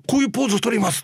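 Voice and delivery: high-pitched mock eagerness; desperately eager. Crew member trying to excite the producer, imitated desperate eagerness. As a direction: pitch it up, trying hard to win them over. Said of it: "とか言って必死になって".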